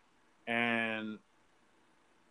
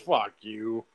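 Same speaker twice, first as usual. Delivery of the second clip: retold disgust; 3 semitones lower than usual. Retold disgusted reaction to corny line.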